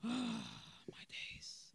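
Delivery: whispered, low and weary; whispered sigh. Real, not acted. Vlogger overwhelmed by the topic, weary whisper. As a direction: breathe it out exhausted.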